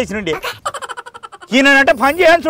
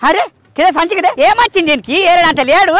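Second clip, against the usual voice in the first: normal talking voice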